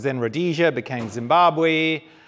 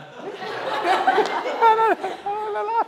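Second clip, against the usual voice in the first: a big high burst of laughter at his own joke; hearty laugh. Real, not acted. Guest speaker laughing at his own African joke, big laugh. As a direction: let a real laugh break out, high and bright.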